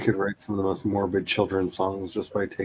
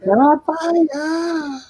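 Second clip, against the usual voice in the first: high drawn-out alarm; panicked cry; 5 dB louder, slower than usual. Real, not acted. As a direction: cry out in shock. Alarmed shout in game.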